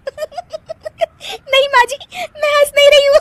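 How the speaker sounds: very high giggly protest; laughing denial. Acted. Daughter-in-law tells mother-in-law she isn't laughing, laughing hard. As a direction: deny it while bursting out laughing. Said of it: "फिर से हंस रही हो और बोल रही हो".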